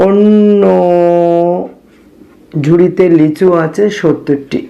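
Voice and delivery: one word stretched out long and level; drawn-out write-along word; dictating while writing. Real, not acted. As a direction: hold the word while the pen catches up. Writing the next line aloud.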